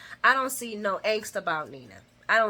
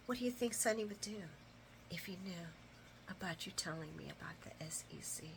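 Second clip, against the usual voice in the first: low, menacing whisper, imitated; hushed, deliberate whispered threat; whispered death blow; 6 semitones lower, 23 dB quieter than usual. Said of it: "She whispers it like it's a death blow".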